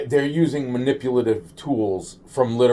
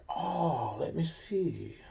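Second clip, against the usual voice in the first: lightly put-on Chinese accent, higher and softer; a quick, soft Chinese-accent aside, a bit higher; mock Chinese accent; 5 semitones higher, 12 dB quieter, much slower than usual. Vlogger jokingly putting on a Chinese accent, softer and higher. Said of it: "I meant to sound Chinese on that one".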